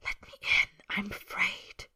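frightened, voiceless whisper; breathless whisper. Acted. Boy whispers to the wall to let him hide, breathless whisper. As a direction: whisper it on barely any breath. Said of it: "barely breathing as he whispers".

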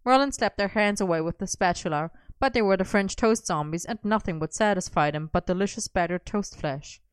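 ordinary delivery

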